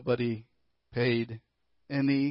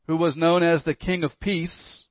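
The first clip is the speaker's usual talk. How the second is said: ordinary talk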